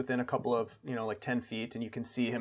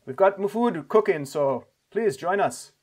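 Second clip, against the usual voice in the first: lightly voiced host, raised pitch; inviting camp leader; 6 semitones higher, 4 dB louder than usual. Camp leader invites travelers to supper, lightly voiced welcome. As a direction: offer hospitality in a light character voice, slowing at the end.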